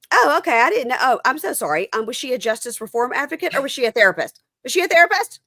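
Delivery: high, loud sarcastic apology building to incredulous questions; mock indignation. Woman in the car mocking the advocate's credentials, mock indignant.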